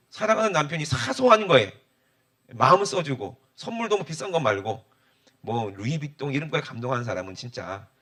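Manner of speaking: usual speaking voice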